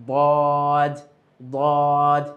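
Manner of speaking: বদ said thick and heavy, the daad pressed full against the molars; heavy Arabic daad. Shown as a demonstration. Teacher repeating the heavy daad for students to copy. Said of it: "যদি এইভাবে আমরা আওয়াজটাকে মোটা না করি".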